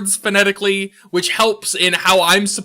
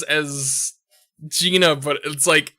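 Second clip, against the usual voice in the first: ordinary talk, nothing marked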